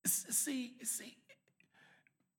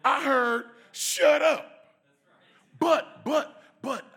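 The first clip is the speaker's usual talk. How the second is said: much louder, shouted punchline; preacher's shout; 6 semitones higher, 16 dB louder, slower than usual. Real, not acted. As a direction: shout it to the back row.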